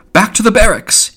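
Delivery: loud, high-pitched military order; stern command. A narrator's reading. Mulligan orders an imagined troop back, stern shout. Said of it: "he said sternly".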